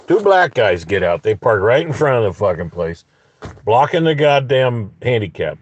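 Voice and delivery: ordinary delivery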